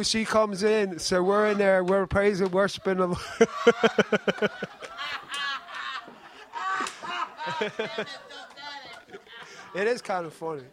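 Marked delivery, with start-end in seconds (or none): 4.5-9.7 high, helpless laughter; long run of high giggling laughs; real laughing fit. Real, not acted. Speaker breaks into laughter.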